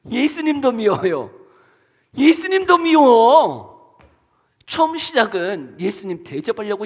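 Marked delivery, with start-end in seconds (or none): 0.1-4.0 put-on slow, higher, louder childish whine; sulky Martha. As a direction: whine it out like a sulking sister. Martha's resentment voiced. (삐친 마르다처럼 높고 느리게 징징대며)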